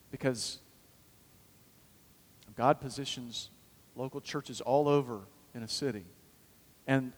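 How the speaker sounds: ordinary delivery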